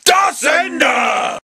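high, loud final cry; dramatic death shout. Acted. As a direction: bellow it as he dies.

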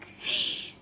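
sharp high gasp; mock scared gasp. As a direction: gasp in playful fright. Draws a mock-scared card.

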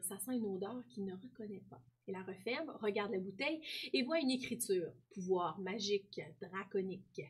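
soft read-aloud of a pupil's story. Acted. Teacher reading Joël's story to pupils, softly. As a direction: read it gently to the class.

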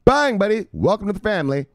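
high, loud "Welcome to the family"; hype welcome. Acted. Streamer greeting a new viewer, hyped and high. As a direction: throw a big hyped welcome at the newcomer.

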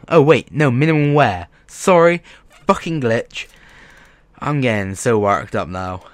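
normal talking voice

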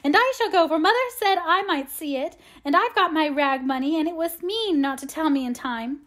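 put-on high-pitched, insistent child; resolute. A narrator's reading. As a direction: insist on it, starting high and loud. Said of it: "adding resolutely".

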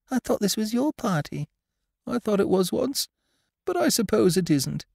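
high, small, wistful voice; Pooh's doleful confiding. A narrator's reading. Pooh and Piglet discussing the party, high wistful voice.

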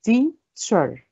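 "teacher" split into "tea", pause, "cher"; syllable by syllable. Shown as a demonstration. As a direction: break "teacher" into two separate beats. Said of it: "Two syllables".

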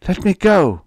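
put-on high, loud child's plea; small boy begging loudly; pleading. A narrator's reading. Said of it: "Robert pleaded".

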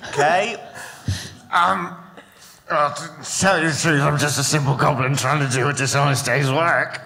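lightly voiced sly goblin; a hint of a scheming goblin.

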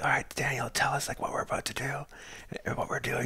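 very soft, near-whispered prompt; hushed on-camera murmur; re-enacted hushed filming. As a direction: keep it down as if hunting. Re-enacts filming quietly while hunting.

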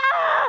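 high, loud overwhelmed cry; exasperated shout. Retold or imitated. Mom overwhelmed by family demands, crying out in frustration. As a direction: let out a frazzled yell. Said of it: "I'm like".

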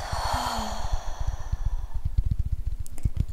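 slow audible sigh out; long whispered sigh. Shown as a demonstration. Showing the listener how to exhale, a long sigh. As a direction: let a long breath sigh out.

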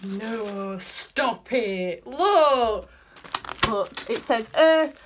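loud, delighted look at this; calling out the bag's label, thrilled; excited. Real, not acted. Vlogger shows the new brush bag to camera, thrilled and loud.

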